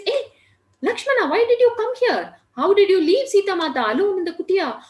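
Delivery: high, loud outburst of shocked questioning. Acted. Rama, shocked to find his brother has left Sita, high alarmed outburst. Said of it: "he's shocked to see Lakshmana and says".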